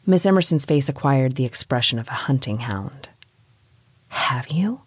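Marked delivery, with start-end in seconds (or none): 4.1-4.8 eager hushed whisper; intense whisper. A narrator's reading. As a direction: breathe it out, hungry for gossip. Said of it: "she asked in an intense whisper".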